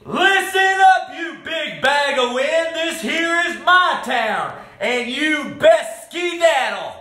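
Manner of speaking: face down a bully at full volume; defiant shout. A narrator's reading. Hero shouts down the tornado.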